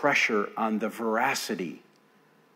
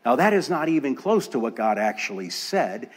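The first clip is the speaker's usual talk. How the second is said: normal talking voice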